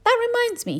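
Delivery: very high sudden voice; big skeleton recalls. A narrator's reading. Big skeleton remembers their plan, high and sudden. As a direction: pipe up remembering. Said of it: "he said".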